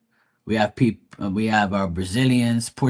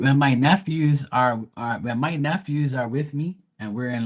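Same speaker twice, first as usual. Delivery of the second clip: normal talking voice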